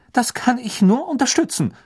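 high, cutting teacher voice; sharp. A narrator's reading. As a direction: snap it out, pitched high. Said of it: "sagte Professor McGonagall scharf".

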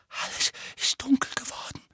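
put-on high, whispery hoarse boy's voice; hoarse frightened whisper; hoarse, shuddering. A narrator's reading. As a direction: croak it faintly, shuddering with dread. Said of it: "sagte Dudley heiser und erschauderte".

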